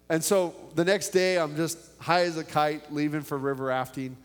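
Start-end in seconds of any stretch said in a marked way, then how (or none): none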